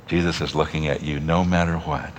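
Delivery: ordinary delivery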